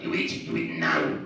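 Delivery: mock menacing, drawn-out low growl; maniac waiter. Waiter standing over a diner like a prison guard, mock menacing. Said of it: "like a maniac, and the waiter's like".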